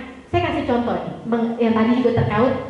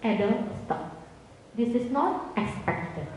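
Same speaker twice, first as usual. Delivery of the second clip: calm firm command; 8 dB quieter, much slower than usual. Shown as a demonstration. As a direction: set the limit calmly without raising the voice. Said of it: "Tenang ke bawah".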